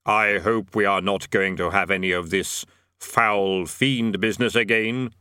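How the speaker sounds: slow, deep, measured Death. A narrator's reading. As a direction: intone it slowly as Death. Death speaks.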